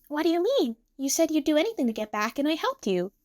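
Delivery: a hint of a high, perky girl objecting; Emu. A narrator's reading. Emu reminds Tsukasa of his promise, lightly protesting.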